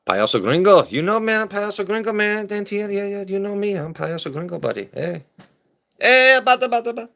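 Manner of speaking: hammed-up high comic persona; high put-on character. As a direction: pitch way up as a goofy gringo character.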